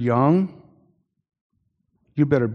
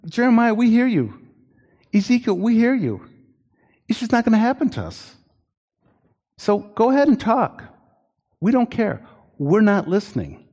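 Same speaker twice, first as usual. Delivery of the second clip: high-pitched, mocking voice of careless listeners; mock them, shrug off every warning; dismissive people; 8 semitones higher than usual. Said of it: "In other words".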